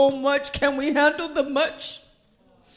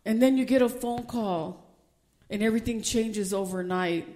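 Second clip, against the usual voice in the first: ordinary delivery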